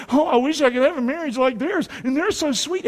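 high-pitched mock gushing; gushing admirers. Churchgoers admiring another couple, high mock gushing. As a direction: imitate people cooing over a couple. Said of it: "they used to say".

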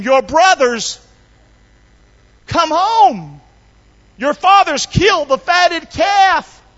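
high, loud, stretched-out proclamation; preacher acting a servant hollering news; excited servant's announcement. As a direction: shout the news out high and slow.

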